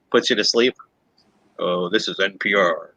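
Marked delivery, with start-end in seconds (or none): 1.6-2.9 lightly voiced low, sleepy public-radio host; NPR voice. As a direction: imitate a soporific NPR announcer, pitched down. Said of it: "get the N P R voice".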